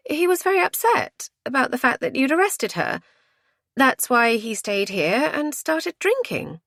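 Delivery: high, insistent explanation; indignant woman, pitched high. A narrator's reading. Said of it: "said Lizzie indignantly".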